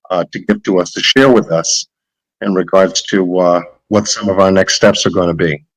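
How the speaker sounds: normal talking voice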